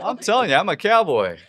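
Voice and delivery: put-on high Southern cowboy accent. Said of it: "Can you do a Southern accent?".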